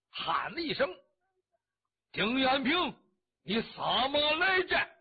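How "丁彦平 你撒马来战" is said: loud, high, drawn-out challenge in a coarse voice; slow, booming warrior's taunt; rough-voiced battle challenge. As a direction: call him out to fight in a big rough voice. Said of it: "他使这个大粗嗓子".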